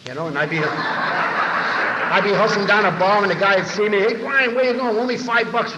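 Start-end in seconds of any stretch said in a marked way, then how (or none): 4.0-5.8 calling out. Retold or imitated. Bar guy calls out to Brian.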